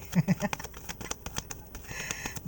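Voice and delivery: light laugh trailing on; amused giggle. Real, not acted. Reader mocks the enemies' surprise, amused giggling. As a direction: giggle at their misfortune. Laughs after asking what happened.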